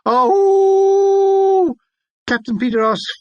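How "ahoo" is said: long, high wolf-like howl, much louder; a soldier howling for cigarettes. Retold or imitated. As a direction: throw back your head and howl like a wolf. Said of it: "came the unearthly howling".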